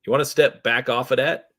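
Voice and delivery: lightly acted street-dealer warning; dealer on alert. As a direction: warn them to back off. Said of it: "He's focused on high alert".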